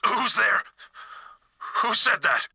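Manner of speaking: high, alarmed call into the dark; jumpy, frightened shout; startled. Acted. Startled by hidden voice.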